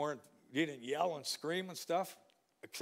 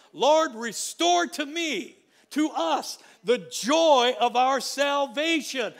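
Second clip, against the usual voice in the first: loud, high, very slow plea; fervent shouted prayer; impassioned prayer cry; 8 semitones higher, 10 dB louder, much slower than usual. Real, not acted. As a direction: cry the prayer out over the church. Quoting prayer in full preaching.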